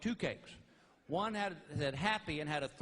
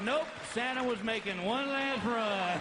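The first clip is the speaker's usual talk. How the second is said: higher, louder, slow dry sarcasm; slow sarcastic comeback; 7 semitones higher, 5 dB louder, slower than usual. Retold or imitated. Husband to wife on phone, slow sarcastic reply.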